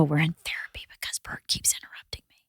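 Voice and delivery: fast conspiratorial whisper. Pretend. Wife whispering a joke to listeners about husband. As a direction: whisper it like a secret aside.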